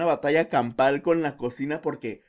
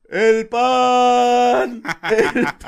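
higher, louder street hawker cry; street vendor call; 8 semitones higher, 10 dB louder, much slower than usual. Retold or imitated. Imitating street bread vendor. (imitando al panadero que pregona el pan a gritos)

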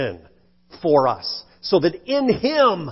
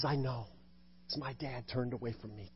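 soft, low, intimate murmur; tender comfort; 6 semitones lower, 20 dB quieter than usual. Acted. Jesus comforting the grieving preacher, tender and hushed. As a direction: speak it like consoling a hurting friend.

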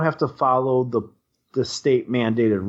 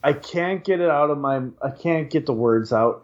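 normal talking voice

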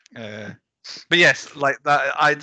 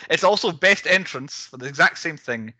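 normal talking voice

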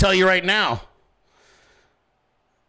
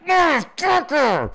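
high, drawn-out slow roar of the curse; retold rage; 9 semitones higher, 3 dB louder, much slower than usual. Retelling the scorpion sting, raging curse. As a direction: re-enact cursing out an attacker, stretched and high. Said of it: "sheer male fucking rage".

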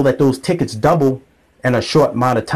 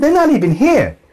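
exasperated retort; 12 semitones higher than usual. Retold or imitated. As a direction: fire it back at her, fed up. Arguing with mom.

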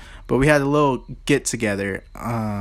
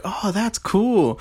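slow, higher sarcastic praise; fake-impressed; 8 semitones higher, much slower than usual. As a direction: drag out the fake admiration. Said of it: "And I was like".

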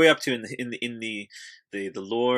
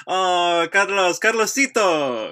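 affectionate calling; 8 semitones higher, 6 dB louder, much slower than usual. Real, not acted. Calling friend in for hug.

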